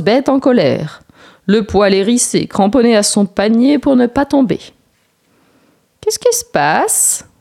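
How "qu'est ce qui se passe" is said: knowing sun. A narrator's reading. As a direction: ask as if you already know. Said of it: "demande le soleil qui a déjà tout deviné". (le soleil, lent et malicieux)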